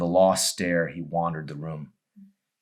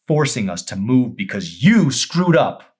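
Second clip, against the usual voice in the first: bitter accusation; 7 semitones higher, 9 dB louder than usual. Acted. Amy's accusation peaks.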